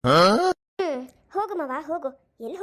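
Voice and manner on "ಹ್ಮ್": a sudden loud sobbing hmph; a choked cry as she turns away; wife sobbing as she walks away from her husband; loud sob. Acted. Wife storming off crying.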